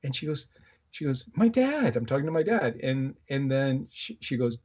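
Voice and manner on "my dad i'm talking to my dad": high-pitched retold exclamation; excited insistence. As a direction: insist it excitedly, imitating a daughter. Daughter retold insisting to doubting friend.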